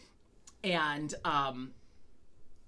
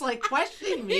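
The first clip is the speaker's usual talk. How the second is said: usual speaking voice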